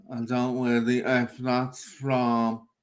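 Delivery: slow, stretched words on a level pitch; drawn-out quote. Retold or imitated. Quoting the man who read the comments, slowly drawn out. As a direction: quote it with long dragged vowels.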